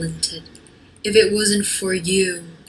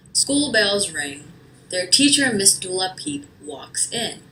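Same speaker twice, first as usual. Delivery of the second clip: ordinary delivery, nothing marked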